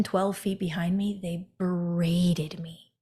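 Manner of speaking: ordinary talk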